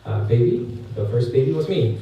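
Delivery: ordinary talk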